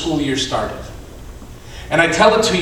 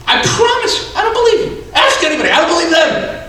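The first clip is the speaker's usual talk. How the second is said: very high, loud comic protest; hammed-up high-pitched arguing; exasperated squabble; 14 semitones higher, 5 dB louder, faster than usual. Man refusing to believe anyone, mock exasperation. Acted back-and-forth argument, comic escalation.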